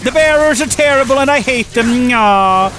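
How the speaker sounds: shrill-free but loud, high, hammy villainess; spiteful mother. Pretend. Imagined mother dismissing her lost son, high and loud hammed-up. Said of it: "They're like".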